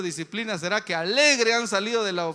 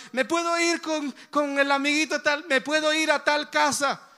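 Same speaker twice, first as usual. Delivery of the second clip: put-on high, eager child asking to go out; lightly voiced child pleading to visit a friend; 6 semitones higher than usual. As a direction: ask like a kid begging a parent. Preacher voices child asking permission.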